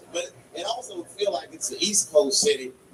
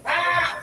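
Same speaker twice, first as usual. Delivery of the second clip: high startled yell; mock shout; 12 semitones higher than usual. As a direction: dodge it with a yell. Imitating the shot.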